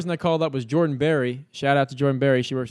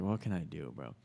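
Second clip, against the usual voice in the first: soft, lower question to himself; quiet aside; 8 semitones lower, 12 dB quieter than usual. Retold or imitated. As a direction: say it softly under your breath. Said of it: "I was like".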